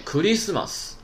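Christmas as Japanese ku-ri-su-ma-su; Japanese pronunciation. Shown as a demonstration. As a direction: repeat "Christmas" in Japanese syllables for emphasis. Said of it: "再强调一遍".

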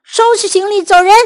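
loud, high, fast order; angry yell. A narrator's reading. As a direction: throw them out with a quick shout. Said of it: "芬斯特夫妇大叫".

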